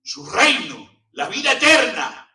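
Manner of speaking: loud, high preaching at full force; fiery preaching. Acted. Preacher proclaiming grace to his congregation, fiery preaching. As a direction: hammer the message home to the congregation.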